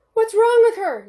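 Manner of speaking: loud, high, frantic; panicked yell. A narrator's reading. Mother yelling at the doctor in panic. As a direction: scream the question at the doctor. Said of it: "Lisa yelled".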